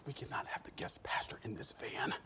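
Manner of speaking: hushed, urgent whisper. Retold or imitated. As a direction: whisper so the pastor can't hear. Wife whispering to husband about guest.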